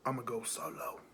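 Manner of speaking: soft whispered quote. Acted. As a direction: breathe it out quietly.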